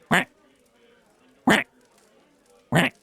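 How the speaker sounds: short clipped "Quack." like a duck; a light duck imitation of "Quack."; duck impression. Shown as a demonstration. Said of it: "do a pretty good duck impression".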